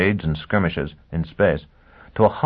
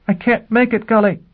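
desperate; 4 dB louder than usual. Acted. Gisbella desperate on radio.

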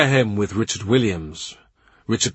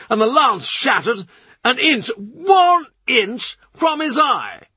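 much higher, rising to a frantic shout; Norfolk panicking; 12 semitones higher than usual. A narrator's reading. Norfolk retelling the king's near death to courtiers, frantic. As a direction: spill the story faster and higher, near yelling.

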